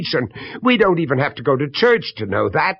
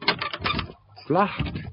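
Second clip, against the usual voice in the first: whispered aside; 4 semitones higher, 8 dB quieter than usual. Acted. Discovers the door locked.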